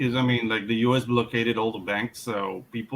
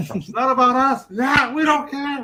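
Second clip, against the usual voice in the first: high, loud, and carefree; carefree partygoer voice; 10 semitones higher, 6 dB louder than usual. Pretend. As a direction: brush it off like a partier. Mimics carefree eighties partygoers.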